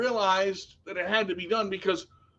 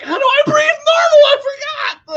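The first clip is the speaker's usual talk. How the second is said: high, loud panicked cry; panicked shout; 11 dB louder than usual. Retold or imitated. Patient told to breathe normally, panicked cry. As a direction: shriek it in comic panic.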